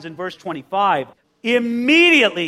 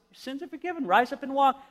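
smiling; 5 semitones higher, 6 dB quieter than usual. Acted. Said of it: "with a great big smile goes".

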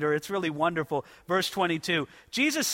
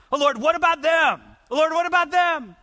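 raised, louder whining deflection; mock protest; 6 semitones higher, 4 dB louder than usual. Worshipper deflecting to others, mock raised protest. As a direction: cry out to point at others. Deflecting cry acted, shout.

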